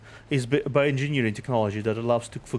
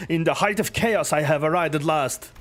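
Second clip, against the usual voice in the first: jovial hero voice; 5 semitones higher than usual. Acted. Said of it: "warmly energetic laughter".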